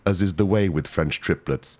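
usual speaking voice

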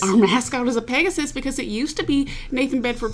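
high-pitched Tennessee accent; proud local in a Southern accent. Shown as a demonstration. Cohost explaining the mascot in character, Southern accent. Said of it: "Can you lock into a Southern accent?".

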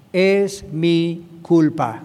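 "Es mi culpa" drawn out word by word; slow humble admission; slow deliberate confession. Shown as a demonstration. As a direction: say "Es mi culpa" slowly, owning it. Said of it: "Tenemos que aprender a decir esto".

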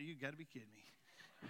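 low, soft aside of a thought; muttered disbelief. Retold or imitated. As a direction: say it under your breath, deadpan. Retold thought watching child's tantrum.

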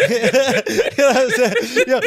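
high cackling laugh through the words; words breaking into real laughter. Real, not acted. Laughing at own Staten Island joke.

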